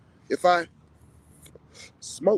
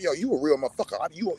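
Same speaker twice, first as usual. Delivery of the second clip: ordinary talk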